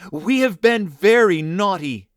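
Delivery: put-on eager children crying out together. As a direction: call out the confession eagerly. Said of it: "they cried".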